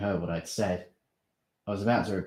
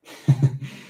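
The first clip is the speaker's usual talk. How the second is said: laugh; 7 semitones higher, 9 dB louder than usual. Acted. Steve laughs.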